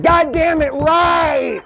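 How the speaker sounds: high, exasperated mimicked shout; mock frustrated agreement. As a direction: holler it like a fed-up woman finally vindicated. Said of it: "she's been so frustrated by it all night".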